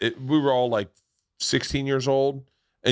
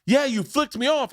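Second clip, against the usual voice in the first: angry accusation. Retold or imitated. Road rager accusing.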